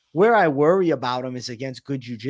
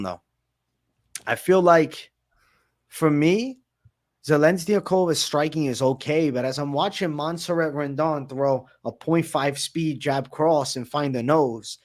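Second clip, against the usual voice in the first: ordinary talk, nothing marked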